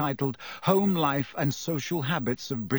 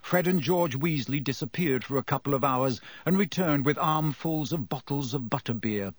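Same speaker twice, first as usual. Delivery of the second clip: ordinary delivery, nothing marked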